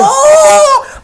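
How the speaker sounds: high, loud shocked cry; a sudden yelp of shock. Retold or imitated. Reacting loudly to a coworker's spicy remark.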